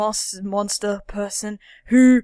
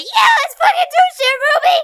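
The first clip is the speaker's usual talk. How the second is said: very high, loud cartoon yell; hammed-up daredevil enthusiasm; mock excited shriek; 9 dB louder than usual. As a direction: imitate a hyper friend cheering Ruby on. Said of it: "always just like".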